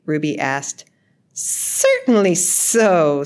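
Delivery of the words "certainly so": slow, long-hissed reply; snake voice. A narrator's reading. Snake confirms his promise to the mouse, hissing. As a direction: linger on every s like a snake. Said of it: "said Snake".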